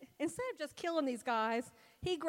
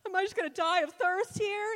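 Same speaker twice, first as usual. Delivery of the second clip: higher and louder, melodramatic whine; dramatic complaint; 6 semitones higher, 8 dB louder than usual. Retold or imitated. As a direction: moan it like a martyr instead of asking. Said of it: "that aggravating feeling".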